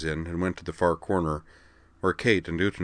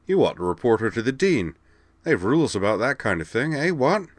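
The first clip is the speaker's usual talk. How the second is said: raised-pitch indignation, climbing at the end; indignant; 6 semitones higher, 4 dB louder than usual. A narrator's reading. Devlin urging Kate to report the counselor, indignant. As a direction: protest it, pushing up on the final tag. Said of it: "Devlin Carter noted indignantly".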